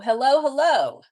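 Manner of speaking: hello called out loud and high; loud greeting call. Real, not acted. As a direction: sing out a big welcome to someone arriving. Calling out a greeting to arrivals.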